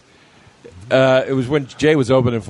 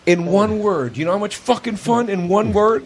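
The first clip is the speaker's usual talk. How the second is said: higher, eager promise of fun; hyped up friend; 6 semitones higher than usual. Retold or imitated. Friend hyping up a night of fun, eager and higher. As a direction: sell him on a wild night out. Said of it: "And he's like".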